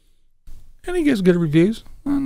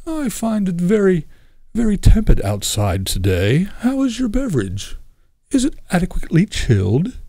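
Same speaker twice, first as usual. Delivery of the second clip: prissy, over-polite chatter pitched higher; mock prim gentleman; 7 semitones higher than usual. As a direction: ham up a stiff, overly proper bar chat with a buddy. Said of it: "Do you sit at the bar and go".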